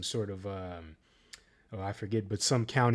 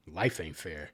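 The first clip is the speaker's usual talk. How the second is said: calm dismissive; 5 semitones lower, 3 dB quieter than usual. Retold or imitated. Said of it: "to calm when things are not in her favor".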